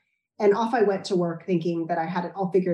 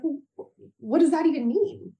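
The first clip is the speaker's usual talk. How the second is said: exasperated; 8 semitones higher than usual. Retold or imitated. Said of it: "I'm like".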